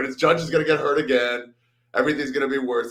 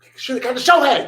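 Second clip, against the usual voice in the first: high, loud fan outburst; exasperated shout; 13 semitones higher, 8 dB louder than usual. Real, not acted. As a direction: yell it out as a fan's lament. Fan's outburst over his team's season.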